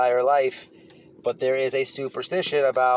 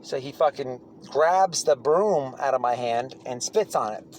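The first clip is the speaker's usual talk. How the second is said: ordinary talk, nothing marked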